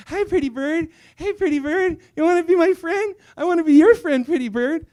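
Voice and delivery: high-pitched pet-talk to a bird; child cooing to bird. Retold or imitated. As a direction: coo hopefully to a pet in a high voice. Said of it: "I still remember myself in a family room sitting there going".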